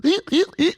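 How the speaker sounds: high-pitched put-on gasps; hammed-up squeaky gasping; mock high gasp. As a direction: imitate someone suddenly set off. Imitated sound of Miranda set off.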